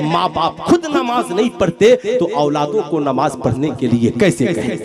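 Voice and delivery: usual speaking voice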